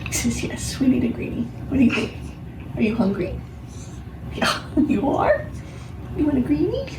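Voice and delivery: high sing-song praise for a good dog; doggy talk. Real, not acted. Owner talking to her dog.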